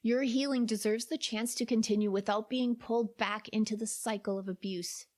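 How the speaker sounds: ordinary delivery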